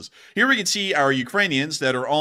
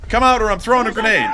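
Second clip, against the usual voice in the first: grenade warning yell; 4 semitones higher, 5 dB louder, faster than usual. Retold or imitated. Soldier threatens prisoner in dugout.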